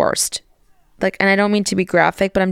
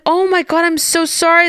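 a hint of her mom apologising, gushing; imitating her mom; 10 semitones higher than usual. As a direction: her mom saying sorry, lightly mimicked. Mom's high apologetic voice.

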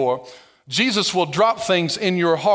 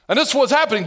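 normal talking voice

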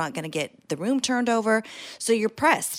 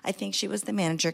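ordinary delivery

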